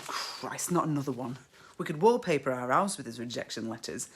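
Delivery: usual speaking voice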